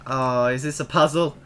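usual speaking voice

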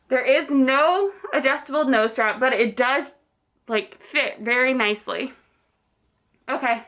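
muffled behind cloth mask; speaking with covered mouth; through a face mask. Real, not acted. Seen 3: Striped cloth mask covers mouth and nose throughout the labelled words.

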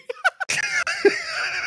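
a squeaky, breathless burst of laughter; high squealing laugh. Real, not acted. Player breaking out of the devil role, shrieking laugh. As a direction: lose it completely in a high wheeze.

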